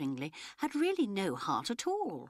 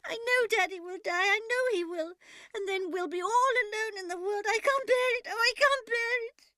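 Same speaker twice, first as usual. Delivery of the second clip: very high, loud sobbing cry; hysterical sobbing; 7 dB louder than usual. A narrator's reading. Daughter sobbing in a corner of the room. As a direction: wail it out in hysterics. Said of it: "sobbing hysterically".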